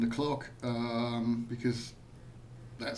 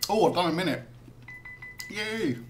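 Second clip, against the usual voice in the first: excited; 6 semitones higher than usual. Real, not acted. Timer challenge finished.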